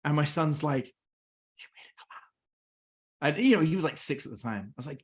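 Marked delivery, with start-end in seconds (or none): 0.8-3.3 awed whisper. Retold or imitated. Son amazed by the steam cloud.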